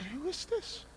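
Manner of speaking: high, soft question; small, high voice questioning the unknown; hushed questioning. Retold or imitated. Asks the unknown voice who it is.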